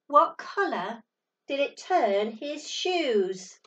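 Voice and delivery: plain reading voice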